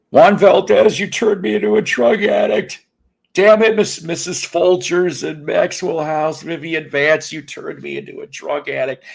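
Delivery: high, loud hammed-up accusation; mock outrage. Host mock-scolding coffee mascots in a high, hammed-up voice. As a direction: scold the coffee brands in a squeaky put-on voice.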